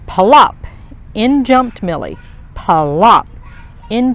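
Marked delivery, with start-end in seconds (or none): 0.1-0.6 popping plop, louder; loud splash plop. A narrator's reading. Splash sound effect. 2.5-3.3 1 splash plop. A narrator's reading. Second splash effect.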